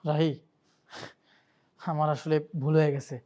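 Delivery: pleading. Acted. Man begs forgiveness.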